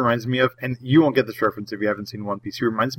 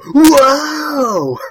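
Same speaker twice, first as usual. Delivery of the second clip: loud, high gasp of awe; amazed whoa; 7 dB louder than usual. Retold or imitated. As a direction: wow them with a big whoa. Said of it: "as the reader, go".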